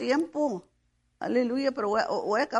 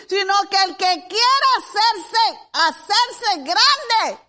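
loud, high, emphatic sermon voice; forceful pulpit delivery ending in a drawn-out cry; preaching; 8 semitones higher, 8 dB louder, slower than usual. Real, not acted. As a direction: proclaim it firmly, phrase by phrase.

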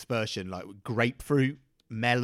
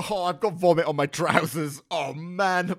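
raised, disgusted groaning; 6 semitones higher, 6 dB louder than usual. Acted. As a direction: groan at the mess on you. Vomit on his trousers.

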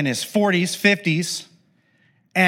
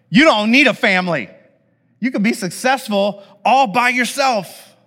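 higher and louder, hammed-up pep talk; mock hype cheer; 7 semitones higher, 4 dB louder than usual. Preacher mocking the individualist mindset, mock cheering. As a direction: cheer him on like a cheesy hype man. Imagined cheer for Joseph's success.

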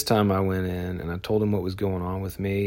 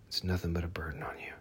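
faster, softer whisper of it's nothing but a burden on you; whispered repeat; 2 semitones lower, 8 dB quieter, faster than usual. Real, not acted. Speaker repeating to listeners baggage is a burden, whispered. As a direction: whisper it again, quick and close. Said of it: "repeat as whisper".

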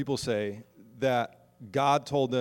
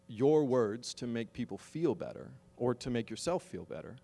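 usual speaking voice

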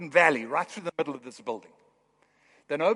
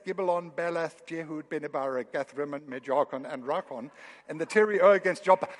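rushed reading; 3 semitones lower than usual. Real, not acted. Preacher rushing the tricky list of place names, fast. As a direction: rattle off the last place name fast. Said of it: "I did that quickly so you wouldn't see".

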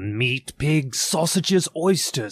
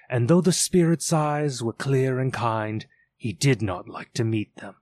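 ordinary delivery

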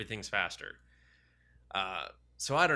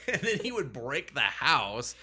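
dramatic build; 5 semitones higher, 5 dB louder than usual. Retold or imitated. Dramatising Hulk rage.

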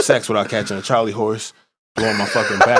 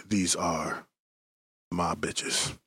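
ordinary talk

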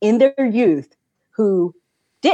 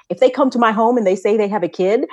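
ordinary delivery

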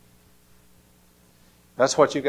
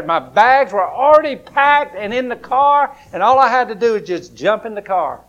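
excited; 7 semitones higher, 9 dB louder than usual. Real, not acted. Excited at bags packed.

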